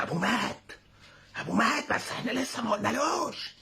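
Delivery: high, hissed secret in a put-on voice; high-pitched serpent hiss; snake hiss. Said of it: "نفس بفحيح الأفعى كده".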